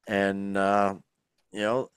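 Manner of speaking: ordinary talk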